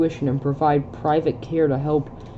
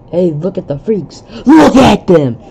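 loud jeering schoolkid voice, pitch up; mocking bully taunt; 4 semitones higher, 12 dB louder than usual. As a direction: sneer at the freaks and shout it. Reading bullies' taunting lines.

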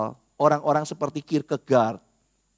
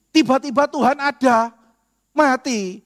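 dramatic reveal; 7 semitones higher, 5 dB louder, slower than usual. Acted. Dramatic twist in the wager.

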